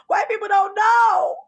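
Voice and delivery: hammed-up cry of despair; mock anguished shout.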